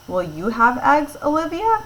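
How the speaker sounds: rising question to Olivia about eggs; questioning rise. Shown as a demonstration. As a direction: pitch climbs high on Olivia, asking. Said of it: "actually asking a question".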